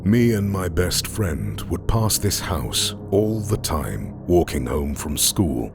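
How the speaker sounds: usual speaking voice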